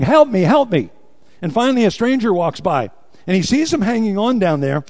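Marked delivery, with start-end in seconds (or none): 0.0-0.9 louder mock cry of help me; a drowning man calling up for help; retold yell for help. Man hanging from a root, calling for help: loud plea. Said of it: "And he's yelling".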